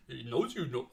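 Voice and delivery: put-on quiet, low Jamaican accent. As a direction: drop into a relaxed Jamaican accent. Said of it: "Still doing it".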